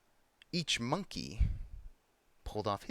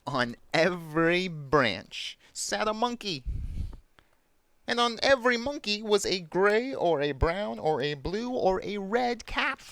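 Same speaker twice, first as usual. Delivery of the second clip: story narration; 6 semitones higher than usual. A narrator's reading. Storyteller revealing the monkeys in the tree, wondrous narration. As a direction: draw out each phrase for young listeners.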